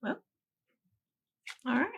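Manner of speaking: ordinary talk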